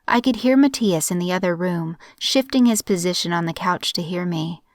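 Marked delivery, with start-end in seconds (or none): none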